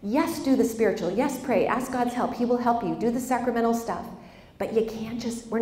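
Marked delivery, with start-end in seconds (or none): none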